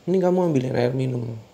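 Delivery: loud, fast barked command; angry order. Acted. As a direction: snap the order at her. Suami memerintah istri dengan marah. (perintah kasar yang cepat dan keras)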